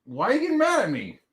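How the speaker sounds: put-on high, fast kid protest; hammed-up squeaky indignation; indignant child. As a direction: imitate an aggrieved child firing back. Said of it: "She's, like, raging".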